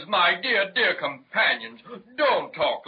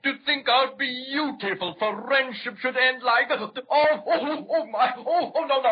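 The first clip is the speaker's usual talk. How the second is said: higher, wailing oh oh oh; mock-tragic lament; 7 semitones higher than usual. Caught dragon lamenting his lost friendship. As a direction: wail melodramatically.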